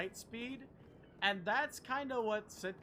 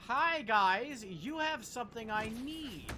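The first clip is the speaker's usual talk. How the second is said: hammed-up menace, louder and higher, slowing at the end; mock villain taunt; 6 semitones higher, 5 dB louder, slower than usual. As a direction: play a cocky villain threatening the enemies. Taunting enemies in game.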